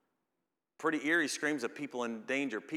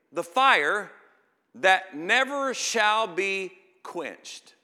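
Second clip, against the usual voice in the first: higher, louder and slow, the words stretched out; preaching at full force; 7 semitones higher, 11 dB louder, much slower than usual. Acted. Preacher thundering the scripture about unquenched fire. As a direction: thunder it, leaning on every word.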